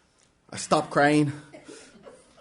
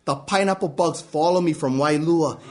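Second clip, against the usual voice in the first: ordinary delivery, nothing marked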